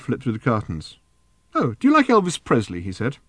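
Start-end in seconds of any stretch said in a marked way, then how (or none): 1.5-2.8 high-pitched, curious discovery; pleasantly surprised question. A narrator's reading. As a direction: pitch it up as if just spotting something. Arthur finds tapes, asks eagerly.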